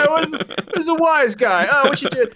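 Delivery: cartoon alien in a thick New York accent. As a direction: bark it like a New York construction guy. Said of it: "really thick New York accents".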